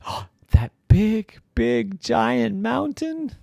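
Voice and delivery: high, slow wonder; awed llama. Acted. Llama gaping at the giant mountain, awed. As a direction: stretch it out in amazement.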